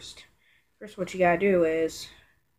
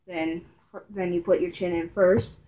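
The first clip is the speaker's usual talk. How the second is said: muffled as mask covers mouth; speaking while pulling mask on; through a gas mask being donned. Real, not acted. Seen 0: Mask pulled over face, chin inside, hands stretching the straps.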